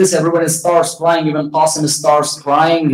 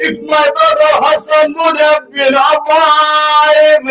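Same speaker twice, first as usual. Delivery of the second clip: mourning sermon lament; 8 semitones higher, slower than usual. Acted. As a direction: preach the tragedy as a rising cry of grief. Majlis lament builds to climax.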